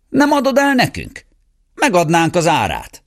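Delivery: high, eager bargaining gentleman; eager lord. A narrator's reading. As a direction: press the poor man to sell. Lords bargaining for the dog.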